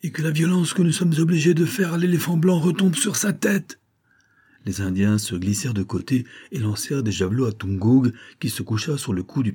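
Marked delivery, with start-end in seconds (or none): 0.0-3.8 higher, raging cry; monk still ranting at his men; vociferating curse. A narrator's reading. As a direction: hurl the curse after the order. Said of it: "vociféra le moine".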